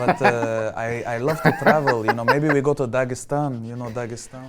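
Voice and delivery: mock Russian accent, selling Dagestan with a chuckle. Host in his Russian accent invites Anastasia to Dagestan, laughing put-on Russian accent. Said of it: "The accent really works".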